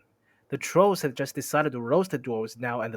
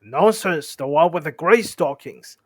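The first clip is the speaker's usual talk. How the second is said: loud, contradicting troll, less high; loud, moderately raised troll retort; William-like troll voice; 3 semitones higher, 8 dB louder than usual. Acted. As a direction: contradict loudly without squeaking. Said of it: "said a voice like William's".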